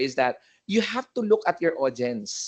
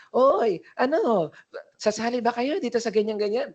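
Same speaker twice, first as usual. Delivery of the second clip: lively crowd emcee; 9 semitones higher than usual. Acted. Host asks the crowd whether they will join in, lively. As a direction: work up a mass audience in a high, lively voice. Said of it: "lively. Yung pang, pangmasa na".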